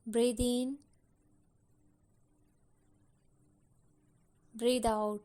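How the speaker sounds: slow, unhurried meditation guide voice; guided meditation. Acted. Guide settles listeners with eyes closed, slow meditation voice. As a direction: ease them into calm with slow, spaced-out phrases.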